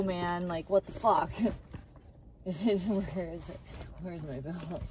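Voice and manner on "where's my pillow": ordinary talk, nothing marked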